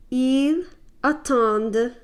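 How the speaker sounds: attendent with a strong, held D; French D sounded clearly; emphasis on the D. Shown as a demonstration. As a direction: lean on the D in attendent. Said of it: "Notice the emphasis on the, the D".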